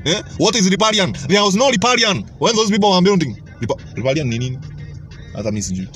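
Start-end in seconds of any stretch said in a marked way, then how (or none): none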